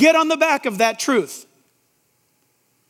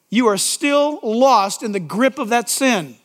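ordinary talk

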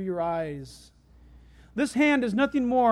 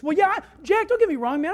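higher, louder casual protest; defensive objector; 7 semitones higher, 5 dB louder than usual. Acted. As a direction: play someone hastily qualifying what they believe. Acts out a listener's casual objection.

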